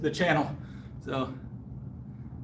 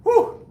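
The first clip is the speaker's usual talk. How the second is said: sharp high whew after the set; effort exhale; 6 dB louder than usual. Real, not acted. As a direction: blow out hard after lifting.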